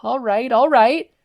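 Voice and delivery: high, loud, slow fake give-up; mock surrender. Izuku fakes surrender to the thugs, hammed-up. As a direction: hands up, pretending to yield. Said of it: "in false surrender".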